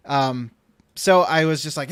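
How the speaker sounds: usual speaking voice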